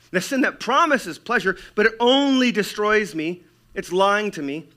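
high, forceful believer's resolve; impassioned denouncing. Acted. Believer denounces own sin.

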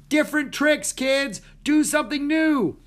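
high, loud yell from offstage. A narrator's reading. Mister Wilson to the kids from the wings, yelling. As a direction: yell urgent orders at the performers. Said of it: "Mister Wilson yelled from the wings".

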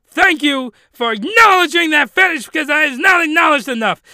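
high, loud excited thanks; excited gushing. Real, not acted. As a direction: rave with delight. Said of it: "gushing about a fetish".

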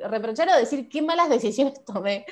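normal talking voice

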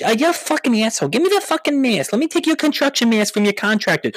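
angry wife Gina. Acted. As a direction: screech at the useless husband. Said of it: "And then Gina goes".

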